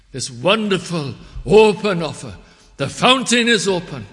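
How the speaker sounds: loud, high proclamation; full-force preaching. Real, not acted. Preacher proclaims open fountain to congregation, preaching forcefully. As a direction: announce the offer at the top of the voice.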